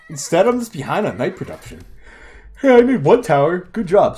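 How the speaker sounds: talking through a yawn; words stretched by a yawn. Real, not acted. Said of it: "Sorry about the yawn".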